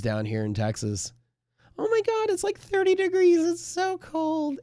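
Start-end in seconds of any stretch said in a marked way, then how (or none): 1.8-4.6 very high, drawn-out mock whine; mock a shivering Texan who can't take the cold; squeaky, slow, hammed-up complaining about the cold; whiny wuss. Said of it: "we're like a bunch of, you know, wusses".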